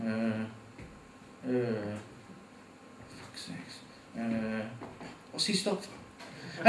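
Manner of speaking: quiet, low frustrated mutter behind the wheel; driver muttering. Retold or imitated. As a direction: grumble it under your breath at the car ahead.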